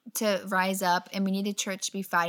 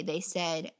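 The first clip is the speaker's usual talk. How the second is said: ordinary delivery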